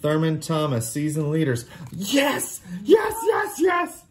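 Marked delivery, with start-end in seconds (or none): 1.9-4.0 excited shout. Real, not acted. Dad gets a card he wanted.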